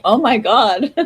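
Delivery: loud retold outburst breaking into laughter; laughing mock shock. Retelling a reaction to a friend's inappropriate memes, laughing mock shock.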